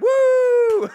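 very high, loud mock crowd whoop; crowd cheer. Imitating the crowd's reaction, loud high whoop. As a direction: cheer like a hyped audience. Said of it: "they would have been like".

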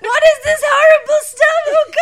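high, loud horrified shriek; shocked shriek. Pretend. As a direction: recoil in shock. Acting a shocked visitor.

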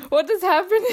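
high, cracking laugh through the words; laughing in disbelief. Real, not acted. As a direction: laugh helplessly at the absurdity. Overwhelmed laughing at situation. (ungläubig lachend)

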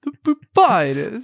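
high, loud mock Walken voice. Co-host echoes the Walken line in a high, loud mock voice. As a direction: echo the announcement, high and loud. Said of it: "you can just imagine the voice".

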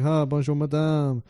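put-on slow, halting schoolboy French accent. Tourist ordering water in France, imitated French accent. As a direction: put on a careful French accent, feeling for words. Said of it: "speaking in my French accent".

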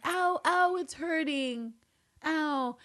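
high, whiny fake ow; badly faked hurt; feigned pain. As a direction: play at being in pain. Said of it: "feigns some sort of pain".

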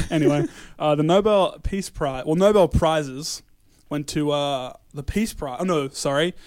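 ordinary talk

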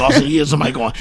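normal talking voice

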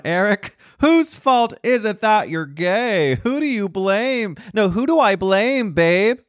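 high, slow, campy interviewer voice; podcast host voice. Pretend. Host asking himself the famous question, high and campy. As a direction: grill yourself like a sassy talk-show host. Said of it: "I gotta ask myself the famous podcast question".

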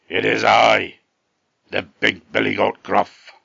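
loud, gruff storybook goat; big gruff voice. A narrator's reading. Big goat answering the troll, big gruff voice. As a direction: announce yourself loudly as a huge gruff goat. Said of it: "in a very big gruff voice".

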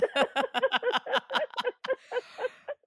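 long high laugh; real laughter. Real, not acted.

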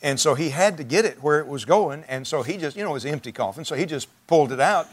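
usual speaking voice

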